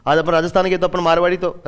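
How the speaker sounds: ordinary talk, nothing marked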